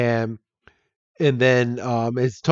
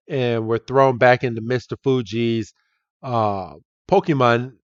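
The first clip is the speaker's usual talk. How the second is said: ordinary delivery, nothing marked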